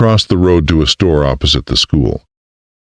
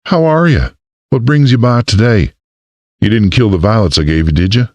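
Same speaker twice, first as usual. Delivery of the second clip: higher, cheerful Southern florist; chatty Southern warmth; Southern accent; 6 semitones higher than usual. A narrator's reading. Florist greeting the mayor in a Southern accent.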